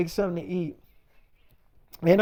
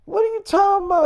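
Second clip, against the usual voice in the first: high, fast, baffled comeback; mock-innocent what-are-you-on-about; incredulous retort; 10 semitones higher, 4 dB louder, faster than usual. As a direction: act caught off guard and fire it back. Boyfriend's confused retort in argument.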